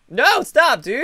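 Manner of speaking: protest. Real, not acted. Stopping friend grabbing food.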